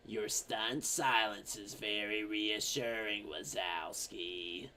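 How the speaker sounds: low, slow, soft creepy monster-lady drawl; Slug Lady voice. Retold or imitated. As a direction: draw out Wazowski low and slow. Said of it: "slug lady's like".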